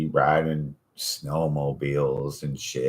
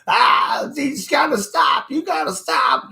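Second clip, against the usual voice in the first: high, loud imitated screams; hammed-up screaming patient; mock screaming; 6 dB louder than usual. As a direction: scream it like someone jolted in the sled, begging them to stop. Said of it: "they'll be screaming".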